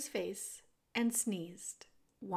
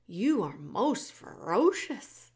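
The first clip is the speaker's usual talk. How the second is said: put-on slow, higher, amazed young girl; awed servant girl; 6 semitones higher, 8 dB louder, slower than usual. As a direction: marvel at him slowly. Meg in awe.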